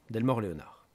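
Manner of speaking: ordinary delivery, nothing marked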